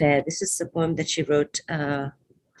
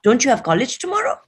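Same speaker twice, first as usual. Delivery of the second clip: loud, higher-pitched scolding questions; 9 semitones higher, 10 dB louder than usual. A narrator's reading. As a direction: scold like a mother catching you up late. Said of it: "she scolds".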